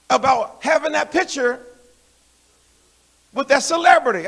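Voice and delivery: usual speaking voice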